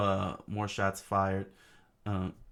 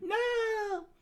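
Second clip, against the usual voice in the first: drawn-out, very high cry, little added volume; mild falsetto scream. Acted. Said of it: "Vivi screamed".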